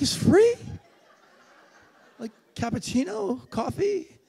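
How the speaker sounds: high, laughing, incredulous "it's free?"; can't believe his luck, voice jumping up; stunned disbelief. Retold or imitated. Stunned that drinks are free.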